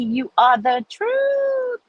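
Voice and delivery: high, slow, drawn-out praise; delighted praise. Real, not acted. Thanking a viewer for a compliment, high slow delight. Reading fan comments.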